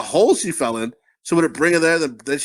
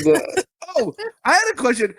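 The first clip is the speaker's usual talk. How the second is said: usual speaking voice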